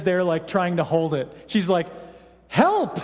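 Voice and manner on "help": mock cry for help. Wife holding sick child's vomit.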